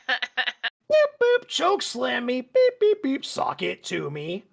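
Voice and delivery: high, squeaky robot voice with beeps. Pretend. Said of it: "say things like".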